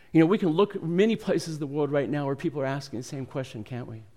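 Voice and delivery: ordinary delivery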